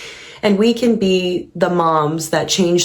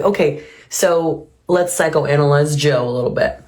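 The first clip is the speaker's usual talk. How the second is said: ordinary delivery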